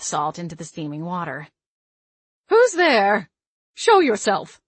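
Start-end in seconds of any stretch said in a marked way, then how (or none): 2.5-4.6 put-on loud, sharp, higher old woman's bark. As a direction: bark it like a startled old witch. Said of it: "I jolted at the barking voice".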